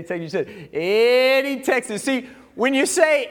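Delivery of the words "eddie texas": higher, drawn-out country twang on the town name; country accent. Retold or imitated. Pastor mimics a listener's answer, country accent. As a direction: imitate the country accent on Eddie, Texas. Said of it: "you said it with the stinking little accent".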